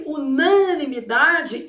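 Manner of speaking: "unanimidade" slowly, with NA stressed before DA; strong pretonic syllable. Shown as a demonstration. Teacher illustrating a pretonic syllable, NA strong in "unanimidade". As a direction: lean on NA in "unanimidade". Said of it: "pronunciada com certa intensidade".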